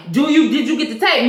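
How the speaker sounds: retold interrogation, pushing for an answer; pressing question. Boss interrogates officer about tape, pressing question.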